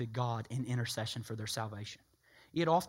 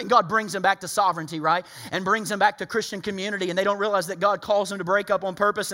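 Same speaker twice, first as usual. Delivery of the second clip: usual speaking voice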